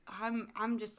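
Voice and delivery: ordinary talk, nothing marked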